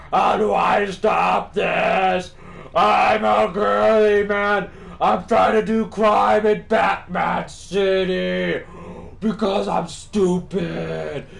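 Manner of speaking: high, loud mock wail; hammed-up helpless bawling, drawn out; whining crook. Player mocking the crook in the car, whiny high yell. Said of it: "he's like".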